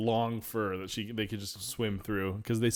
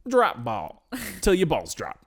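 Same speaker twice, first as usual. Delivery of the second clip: high, louder hype-man slogan; mock slogan; 6 semitones higher, 6 dB louder than usual. Hosts pitching the episode's slogan, in a mock announcer hype. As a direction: sell it like a cheesy tagline. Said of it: "slogan announced".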